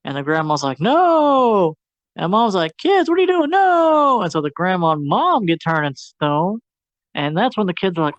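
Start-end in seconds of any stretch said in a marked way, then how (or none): 0.8-1.8 mock shout no. Grandma shouts no. 2.8-4.2 high, panicked mom calling out; alarmed cry. Retold or imitated.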